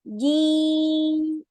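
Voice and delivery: जी loud and high with no breath; crisp clean जी without air; clear voiced jee. Shown as a demonstration. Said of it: "clear sound, बगैर किसी हवा के".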